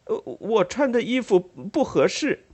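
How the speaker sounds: mumbling a weak excuse; flustered brother. Acted. Joseph's lame excuse.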